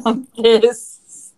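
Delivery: drawn out through laughter; slow, exasperated laugh. Real, not acted.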